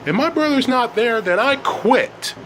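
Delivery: high, heated accusations; angry outburst. A narrator's reading. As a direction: argue back like an upset teenager. Elliot argues back in anger.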